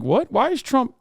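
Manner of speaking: put-on incredulous questioning; mock disbelief. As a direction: play a stunned supporter protesting, pitch jumping up. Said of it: "the same energy here where they're like".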